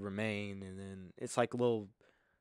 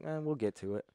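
a hint of a dismissive elf, slightly higher; unhurried elf; 4 semitones higher than usual. Game master voicing a long-lived elf, mildly unbothered. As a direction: lightly shrug it off. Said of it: "if you're playing an elf".

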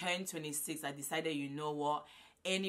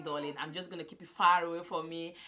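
ordinary talk

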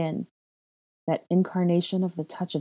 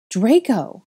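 loud high shout of his name; 6 semitones higher, 7 dB louder than usual. A narrator's reading. Calling out for the missing boy, loud shout. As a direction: call out for him in panic. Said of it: "I shouted".

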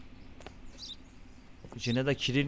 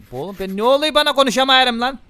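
louder, higher frustrated shout; irritated outburst; 8 semitones higher, 10 dB louder than usual. Real, not acted. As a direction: snap at yourself for fumbling. Annoyed at stumbling over words.

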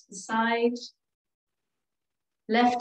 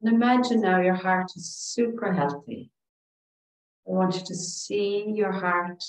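calm, soothing relaxation voice with long pauses; guided meditation; 2 semitones lower than usual. Real, not acted. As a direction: guide them gently through each breath, leaving space. Leads breathing and meditation.

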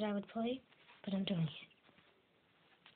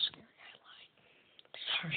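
whispering; 5 semitones lower, 3 dB quieter, much slower than usual. Real, not acted. Admits fear in a whisper.

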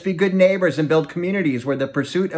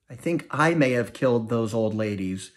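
ordinary delivery

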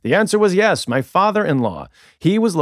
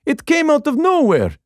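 put-on heavy Russian accent; 12 semitones higher than usual. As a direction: exclaim it in a thick Russian accent. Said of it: "In his heavy Russian accent".

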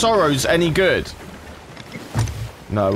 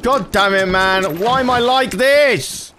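higher exasperated moan, slowing at the end; exasperated outburst; 8 semitones higher than usual. Real, not acted. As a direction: whine at yourself in frustration. Exasperated at own failure.

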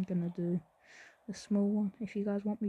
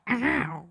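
loud imitated ow of a hurt schoolmate; a put-on louder pained ow; mock yelp of pain; 3 semitones higher, 8 dB louder than usual. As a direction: yelp it like the boy clutching his shoulder. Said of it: "He went".

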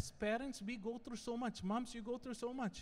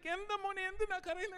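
put-on mother soothing baby; 10 semitones higher than usual. Wife soothing crying baby, Malayalam.

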